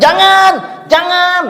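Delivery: high, loud, panicked cry of no; desperate scream. Acted. Buried dead man screaming as soil covers him, desperate shout. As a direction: scream as the earth falls on you. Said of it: "dia teriak".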